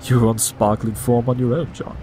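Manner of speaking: ordinary talk, nothing marked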